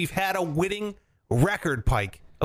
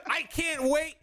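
normal talking voice

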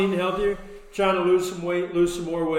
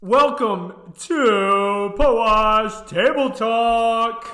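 very slow, much higher and louder announcer call; show opener; 8 semitones higher, 8 dB louder, much slower than usual. Acted. Host opening the show to listeners, booming announcer. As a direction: stretch it out like a game show host.

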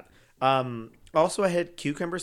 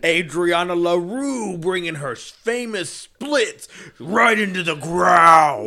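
slow hype announcer building to shout; drag brunch ad; 4 semitones higher, 6 dB louder, slower than usual. Acted. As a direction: build it to a bellowed finish.

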